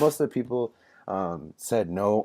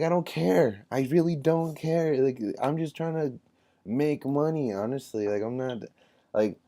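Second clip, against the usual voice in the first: higher, mock-exasperated I-don't-care; inner don't-care; 8 semitones higher than usual. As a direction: toss it off like a bored salesman who just wants money. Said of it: "I'm just sitting here like".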